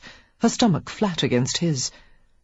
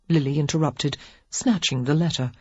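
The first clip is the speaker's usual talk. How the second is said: plain reading voice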